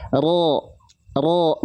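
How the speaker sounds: low heavy "Ro" with O-like vowel and no pout; thick ro, unpouted. Shown as a demonstration. Teacher has students watch the mouth, thick unpouted ro. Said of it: "Mulut kita nggak monyong".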